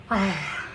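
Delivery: audible breathy sigh; nervous sigh. Acted. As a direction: let out a sigh under pressure. Nervous speaker sighs before admitting pressure.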